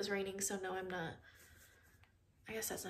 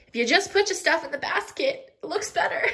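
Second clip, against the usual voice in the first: laughing; 7 semitones higher, 4 dB louder than usual. Real, not acted. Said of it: "laughing at own tip".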